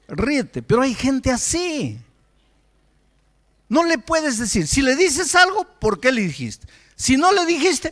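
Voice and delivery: exasperated, forceful preaching; predicación enérgica. Acted. (predicación exasperada, con fuerza)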